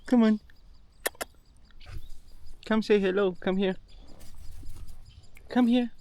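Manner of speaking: high-pitched coaxing call; coaxing a dog. Real, not acted. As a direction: call her over in a high voice. Calling the dog over.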